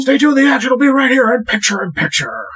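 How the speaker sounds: high-pitched rapid-fire wrestling commentator; Excalibur impression. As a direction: race through it like a ringside announcer going to break. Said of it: "Am I Excalibur from A E W?".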